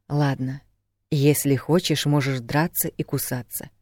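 ordinary delivery, nothing marked

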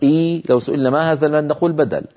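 ordinary talk

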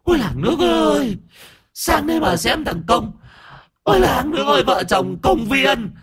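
very high wailing cry for the neighbours; wailing to the village. Acted. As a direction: shriek it to the whole village. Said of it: "bà cụ Ti chửi".

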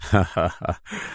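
low-pitched acted laugh; low chuckle. A narrator's reading. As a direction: chuckle low as the confident hero. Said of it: "ضحك وهو يقول". (ضحكة منخفضة)

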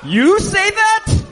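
slow, squeaky, outraged; high indignant disbelief. Real, not acted. Friend squeals back at the host's teasing. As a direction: squeal it back in mock offense.

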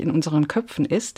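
ordinary delivery

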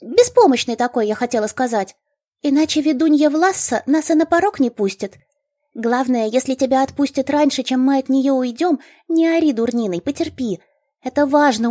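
high, eager gnome voice; chirpy gnome explaining. A narrator's reading. Gnome instructs.

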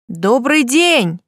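calling out loudly, slowly; a loud, drawn-out hello into the empty hall; loud greeting. A narrator's reading. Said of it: "громко поздоровалась я".

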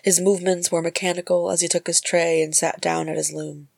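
ordinary delivery, nothing marked

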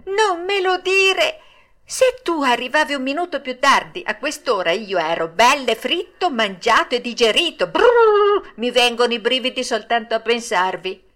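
scared, high-voiced and dramatic; still trembling with fear. A narrator's reading. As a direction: shudder at the narrow escape. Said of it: "che tremava ancora dalla paura".

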